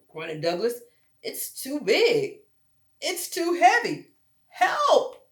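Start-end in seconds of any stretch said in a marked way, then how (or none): 1.2-5.3 high, loud, slow strain rising to a cry for help; straining bear cub. Acted. Douglas stuck under the heavy rock, straining then shouting. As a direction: heave under the weight, then call out.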